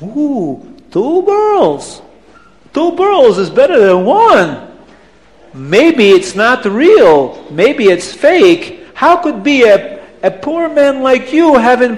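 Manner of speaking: put-on swooping, accented wonder turning to doubt; high, drawn-out incredulous accent; amazed Abdallah. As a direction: marvel slowly, then question suspiciously. Abdallah marvels, then doubts.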